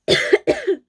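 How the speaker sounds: sharp loud cough; a sudden harsh cough; hard cough. Real, not acted. Coughing fit mid-story.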